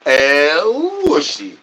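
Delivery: slow, stretched El Whooshy call; drawn-out catchphrase. Pretend. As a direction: stretch it out like a showman. Repeated character catchphrase bit.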